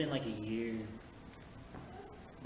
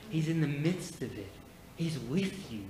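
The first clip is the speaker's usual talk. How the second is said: ordinary delivery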